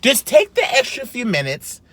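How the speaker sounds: high-pitched, emphatic complaint; exasperated rant. Real, not acted. Vlogger fed up with hair on chicken, exasperated rant.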